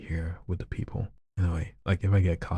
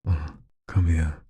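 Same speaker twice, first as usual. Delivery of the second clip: flirty request read. Pretend. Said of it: "someone wants me to say".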